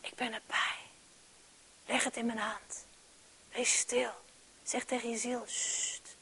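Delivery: the Holy Spirit whispering to a struggling listener; soft whisper. Acted. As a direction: whisper it close and still. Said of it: "Die zegt".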